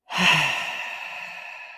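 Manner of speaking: long audible sigh of relief. Shown as a demonstration. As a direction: let all the air out in one relaxing sigh. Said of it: "just sometimes to sigh it out".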